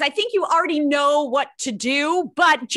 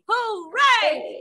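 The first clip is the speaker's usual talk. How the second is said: cheer; 7 semitones higher than usual. Shown as a demonstration. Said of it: "shout hooray".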